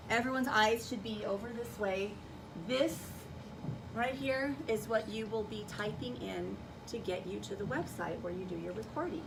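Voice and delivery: ordinary talk, nothing marked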